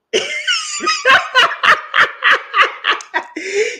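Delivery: a squealing burst of laughter, way up high; real shrieking giggle at her friend. Real, not acted. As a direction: cackle high and loud in delight. Cracking up at the friend.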